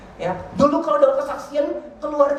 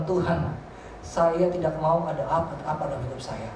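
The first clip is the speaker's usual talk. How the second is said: low, soft retold prayer to God; hushed, reverent plea; quiet prayer; 7 semitones lower, 7 dB quieter than usual. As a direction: pray it softly and low, in trust. Retells own prayer to God.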